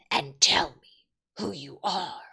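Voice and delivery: growling wolf whisper. A narrator's reading. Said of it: "said in a growling voice".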